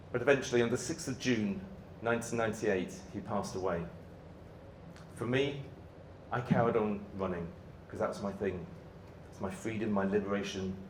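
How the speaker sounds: low, very soft, with long pauses; real quiet sorrow, voice sinking low; subdued grief. Real, not acted. Recounts father's death, carrying on.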